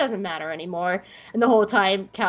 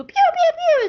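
high, squeaky pew-pew blaster noises; playful high-pitched zaps; mock laser fire; 7 dB louder, slower than usual. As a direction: fire off cartoon laser sounds. Imitates laser fire in battle.

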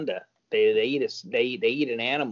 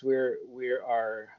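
ordinary delivery

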